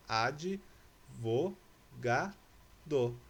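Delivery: "ad, vo, ga, do" one at a time with pauses; each piece of "ad, vo, ga, do" set apart; split into syllables. Shown as a demonstration. Teacher separating the little word for pupils, syllable by syllable. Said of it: "vou separar essa palavrinha em sílabas".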